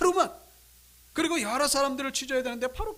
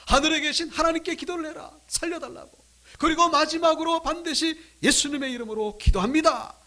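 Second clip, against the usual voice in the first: louder, higher, insistent urging; emphatic instruction; 6 semitones higher, 8 dB louder than usual. Retold or imitated. Sister urging a friend to pray, emphatic. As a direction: urge it firmly, raised pitch and volume. Urgent prayer instruction being relayed.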